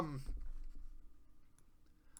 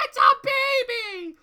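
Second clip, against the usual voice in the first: Pinkie squeal; 12 dB louder, faster than usual. Retold or imitated. Pinkie voiced in retelling.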